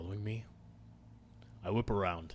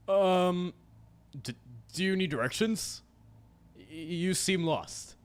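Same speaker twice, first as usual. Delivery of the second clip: high, halting, frightened stammer; trembling voice; 8 semitones higher, 3 dB louder, slower than usual. Acted. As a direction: stutter it out, barely holding together. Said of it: "I can't keep the tremor out of my voice".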